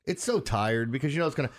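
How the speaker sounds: ordinary talk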